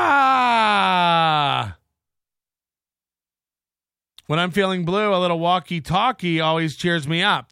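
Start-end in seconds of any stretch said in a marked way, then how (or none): 0.0-1.8 sudden yelled ah; loud shout. Real, not acted. Host shouting out on air. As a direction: blurt out a loud ah.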